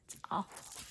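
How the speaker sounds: imitated American "awful", open AH vowel; whispered attempt at American "awful"; American accent. Shown as a demonstration. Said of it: "here you'd say".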